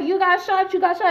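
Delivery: high, rapid-fire repeated warning; panicked alarm. Retold or imitated. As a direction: imitate a boyfriend panicking. Boyfriend panics at her wound.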